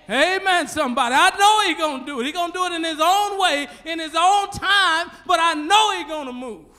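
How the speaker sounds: high, loud whooping sermon; preaching at full force. Real, not acted. Preacher stirring his congregation, loud and high. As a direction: ride the rhythm up to the church.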